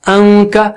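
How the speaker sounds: انکا with the n held as a nasal hum; sustained, level nasal n in انکا; light ghunna. Shown as a demonstration. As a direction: hum through the nose, then release into کا. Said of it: "اسی طرح".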